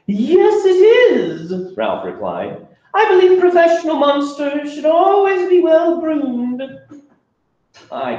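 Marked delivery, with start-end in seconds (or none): 0.1-6.6 a very high, silky, unhurried Ralph; silky monster voice. Acted. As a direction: charm them like a polite salesman monster. Ralph replies proudly.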